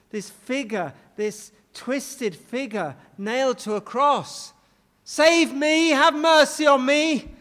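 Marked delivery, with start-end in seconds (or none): 5.0-7.3 higher, louder plea; crying out. Retold or imitated. Thief crying out to the crucified figure, loud plea. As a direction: call out for mercy. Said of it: "he was crying out to this".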